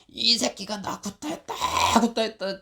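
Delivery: high, shouted accusation in an imitated voice; retold angry yell. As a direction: mimic a man screaming that he was beaten. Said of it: "소리 지르고". (맞았다고 고래고래 소리치는 흉내)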